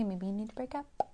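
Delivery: very soft, hushed voice; attempted ASMR. Co-host trying ASMR on relationship advice, very soft. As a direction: have a go at soft ASMR murmuring. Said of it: "I don't know how to do A S M R".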